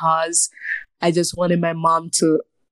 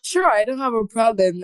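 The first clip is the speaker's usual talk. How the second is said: ordinary talk, nothing marked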